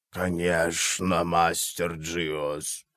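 low, slow, bubbling alien voice; gurgling tentacle alien. A narrator's reading. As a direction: gurgle the words out slowly through slime. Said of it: "пробулькал Орбалин".

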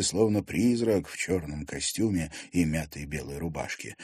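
ordinary delivery

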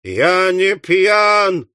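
high, long-drawn wail; drawn-out howl of denial; wailing protest. Acted. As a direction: wail every word out slowly in protest. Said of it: "завопил жирный парень".

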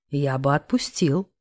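low, slow puffed male voice; lightly strained, low-voiced reply; puffing. A narrator's reading. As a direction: puff it out slowly and low. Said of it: "пропыхтел Корф".

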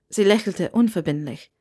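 ordinary delivery, nothing marked

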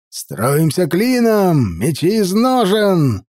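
slow, stretched, rising commands; drill-sergeant call to form up; gruff barked parade orders. Acted.

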